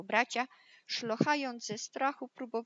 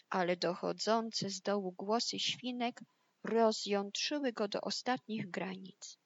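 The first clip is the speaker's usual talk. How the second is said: ordinary delivery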